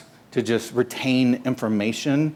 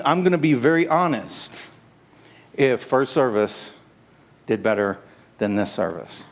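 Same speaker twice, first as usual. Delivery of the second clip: normal talking voice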